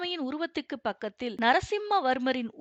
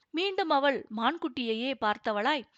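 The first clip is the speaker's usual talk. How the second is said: ordinary delivery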